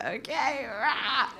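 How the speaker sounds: awkward giggly okay; laughing reply. Retold or imitated. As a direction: laugh it out nervously. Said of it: "I was like".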